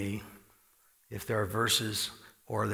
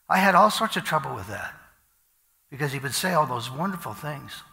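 ordinary talk, nothing marked

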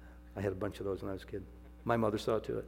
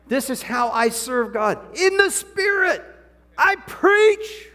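much higher, much louder, slow shout; fiery preaching; 15 dB louder, much slower than usual. Real, not acted. Preacher crying out Paul's words to the church, full force. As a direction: proclaim it at the top of your voice. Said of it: "preacher climaxing on Paul's words".

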